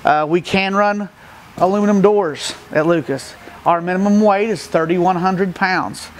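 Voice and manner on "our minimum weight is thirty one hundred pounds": ordinary delivery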